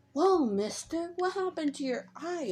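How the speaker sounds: put-on wide-eyed child asking a grown-up; Izuku. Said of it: "As Izuku says".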